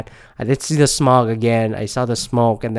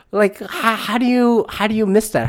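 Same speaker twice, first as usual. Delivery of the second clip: high, pitched-up disbelief; higher, outraged how-do-you; incredulous disbelief; 10 semitones higher than usual. Real, not acted. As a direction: ask it like you can't believe it. Outraged rant about plane downing.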